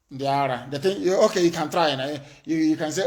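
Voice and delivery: usual speaking voice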